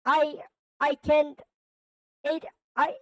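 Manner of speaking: high, halting panicked denial; acted fear, broken into short bursts; fearful villain. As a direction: stammer in disbelief, pitch held high. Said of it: "Infinite then began shaking in fear".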